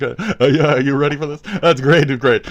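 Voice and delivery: ordinary talk, nothing marked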